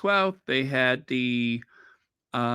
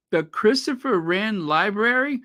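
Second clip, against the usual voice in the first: excited surprise; 7 semitones higher than usual. Retold or imitated. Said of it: "but I said".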